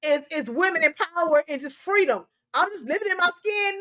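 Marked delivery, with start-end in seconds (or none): 2.5-3.8 higher, sassy put-on voice; mocking quote. Host mocking people who excuse obesity, sassy. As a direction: mimic the self-justifying line mockingly. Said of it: "Quit acting like".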